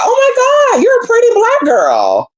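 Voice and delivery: very high, gushing mock admiration; gushing man. The guy on camera gushing over her, very high. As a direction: squeal it like he's smitten. Imitating guy's reaction on camera.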